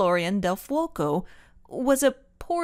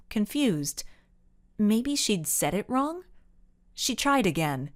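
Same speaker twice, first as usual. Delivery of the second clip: ordinary delivery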